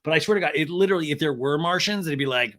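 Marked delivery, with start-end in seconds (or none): none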